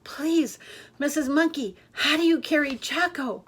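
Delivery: high, breathless squeak. A narrator's reading. Katie out of breath, squeaking to Missus Monkey. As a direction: squeak it out, winded. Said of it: "she managed to squeak".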